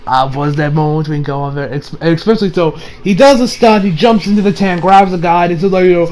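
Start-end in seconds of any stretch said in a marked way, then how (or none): none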